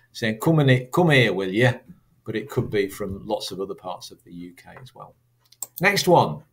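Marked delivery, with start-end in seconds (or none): 0.4-1.8 northern accent with dropped H; broad, informal northern regional accent; northern English accent. Shown as a demonstration. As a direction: say it like a northerner, louder and higher. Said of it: "So it could be from north".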